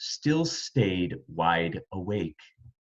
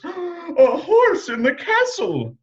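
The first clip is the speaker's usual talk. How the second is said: much higher, louder shocked scream; 6 dB louder than usual. A narrator's reading. Queen seeing a horse indoors, screaming. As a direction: shriek it in alarm. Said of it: "the queen screamed".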